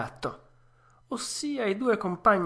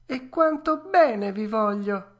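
Pinocchio, moved; 8 semitones higher, 5 dB louder than usual. A narrator's reading. Puppet thanking the fairy, high and tender.

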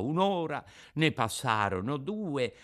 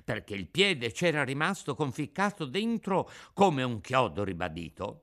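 ordinary delivery

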